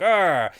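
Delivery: loud mock grumbling "arr"; mock dad growl. Retelling the annoyed dad's objection, mock growl. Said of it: "but the dad's like".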